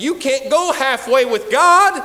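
shouted slowly, every word driven home; slow booming preacher shout; loud rebuke. Retold or imitated. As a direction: bellow it out like a final warning.

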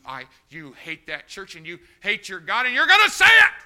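loud raging demand; furious shout. Retold or imitated. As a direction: yell it at her.

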